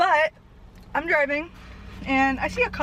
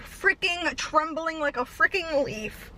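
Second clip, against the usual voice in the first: normal talking voice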